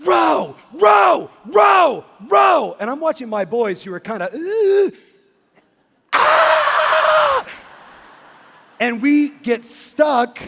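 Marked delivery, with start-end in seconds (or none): 0.0-2.8 much higher, louder repeated shout; screaming at the top of her lungs. Retold or imitated. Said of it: "screaming at the top of her lungs". 4.3-7.4 very high rising scream; terrified shriek. Retold or imitated. Boys reacting in terror.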